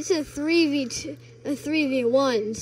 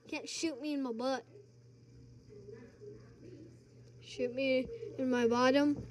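quiet; 15 dB quieter than usual. Real, not acted. Softly after getting shot.

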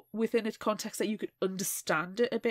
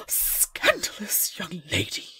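slow, mock-prim scolding; hammed-up prim reproach; scandalised scolding; 2 semitones lower, slower than usual. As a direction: tut at the naughty girl, slowly. Mock scolding as Victorian lady.

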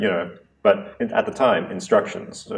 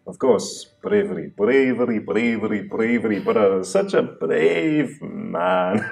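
mocking brother; 2 semitones higher than usual. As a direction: draw out "bravery" to mock him. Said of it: "his brother's like".

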